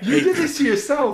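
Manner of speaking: high, loud, laughing gloat; laughing tease. Real, not acted. Friend blames the other for the derailing, laughing.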